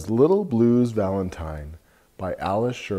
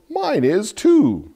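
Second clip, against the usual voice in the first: high goat voice; 13 semitones higher, 5 dB louder, slower than usual. Acted. Goat speaks in picture book.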